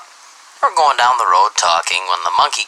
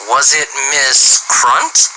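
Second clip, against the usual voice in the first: slow punchline; 3 semitones higher, much slower than usual. Acted. Johnny delivers the punchline.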